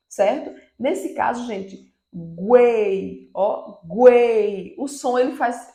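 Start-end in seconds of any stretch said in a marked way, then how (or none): 2.1-3.3 "guei" rising to a strong e, then falling; triangle-shaped sound. Shown as a demonstration. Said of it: "como se fosse um triângulo". 3.8-4.7 "guei" swelling loud on e, then dropping; loud peak in the middle of a stretched "guei"; triangle-shaped sound. Shown as a demonstration. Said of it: "Ele aumenta".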